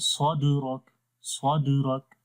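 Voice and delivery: 「سواد راک」のدを強く硬く; wrong forceful د in سواد راک; harsh qalqala demo. Shown as a demonstration. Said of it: "یہ غلط ہے".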